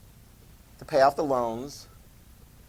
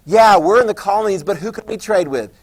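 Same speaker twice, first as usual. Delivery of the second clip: ordinary delivery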